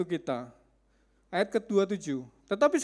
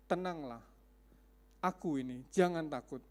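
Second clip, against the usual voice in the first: soft, low gentle comfort; reassuring calm; 6 semitones lower, 7 dB quieter than usual. Acted. Jesus calming his frightened disciples, soft and low. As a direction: speak softly to calm them.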